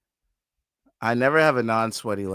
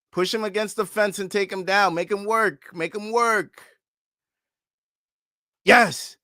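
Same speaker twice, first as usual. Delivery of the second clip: high, pressing shouts of instruction; urgent coaching yells; 7 semitones higher than usual. Real, not acted. Fan coaching the fighter from the couch, urgent yells. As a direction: drive him to the takedown.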